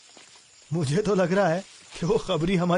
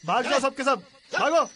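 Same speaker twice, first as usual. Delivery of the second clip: loud shout, rising higher at the end; angry ordering off; 4 semitones higher, 6 dB louder than usual. Acted. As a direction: drive the whole crowd away. Chasing non-paying drinkers away.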